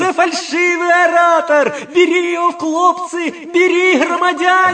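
очень высокий громкий выкрик; откликнуться пронзительным криком; крик тенором. Acted. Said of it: "тонкий тенор". (тонкий кричащий тенор)

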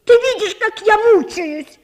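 high, loud, exasperated and despairing; angry desperate shout. A narrator's reading. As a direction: drive her friend out in anguish. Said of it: "злобно кричала Наташа сдержанно раздражённым и отчаянным голосом".

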